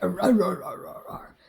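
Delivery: imitating dog growls. Growling like the dog, fading to a whisper. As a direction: imitate the Rottweiler growling when hugged. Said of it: "she'd go like".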